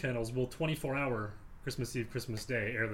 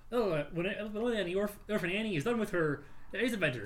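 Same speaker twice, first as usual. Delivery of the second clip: higher, fast-talking ad voice; radio ad man; 5 semitones higher, faster than usual. Retold or imitated. Host mimics the Ovaltine radio ad, ad-man voice. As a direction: rattle off an old radio sales pitch. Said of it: "the ad voice, the ad man".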